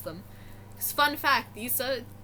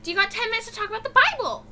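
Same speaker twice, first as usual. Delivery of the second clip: high, quick and eager sales pitch, a bit louder; a hammed-up pushy church lady at the door; pushy missionary, fast; 10 semitones higher, 5 dB louder, much faster than usual. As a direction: rattle it off higher and faster like a pushy door-to-door preacher.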